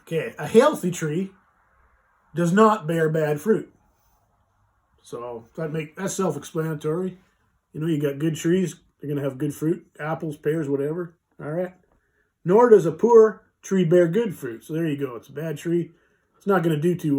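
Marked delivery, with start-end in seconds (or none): 0.4-3.7 slow, deliberate scripture reading. Shown as a demonstration. Reading Bible verse slowly to viewers. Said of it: "I'll read it slow and see". 12.4-14.6 slow, deliberate verse reading. Shown as a demonstration. Reading scripture slowly to his viewers. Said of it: "I'll read it slow and see".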